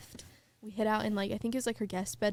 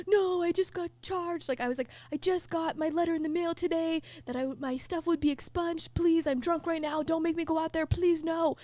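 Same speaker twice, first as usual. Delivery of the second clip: retold panicked pleading; 7 semitones higher than usual. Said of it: "start crying and freaking out".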